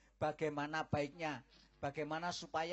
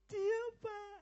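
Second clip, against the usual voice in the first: high, hesitant, soft and halting; a scared girl barely getting words out; frightened; 4 dB quieter than usual. Acted. Daughter afraid to confess to her father, trembling.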